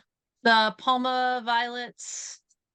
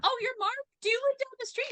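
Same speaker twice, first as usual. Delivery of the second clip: much higher-pitched put-on voice; mock caller; 3 dB quieter than usual. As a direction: play a nosy caller, pitched way up.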